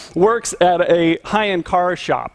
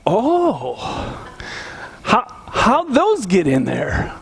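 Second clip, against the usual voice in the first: mock innocent; 4 semitones higher, much slower than usual. Feigned innocence.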